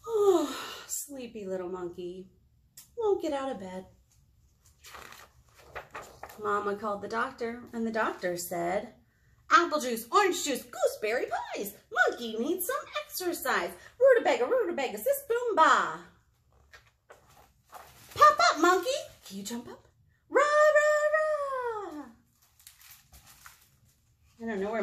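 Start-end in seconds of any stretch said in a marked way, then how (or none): 0.1-19.9 lively picture-book reading; reading to children. Acted. 20.3-22.0 high, loud cheerleader rah; cheer. Acted. Cheerleader chant in story.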